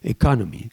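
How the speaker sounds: "economy" with English stress on "con"; stressed second syllable. Shown as a demonstration. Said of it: "l'accent tonique". (accent tonique sur « con » de « economy »)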